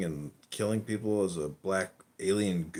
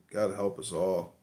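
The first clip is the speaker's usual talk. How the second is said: ordinary delivery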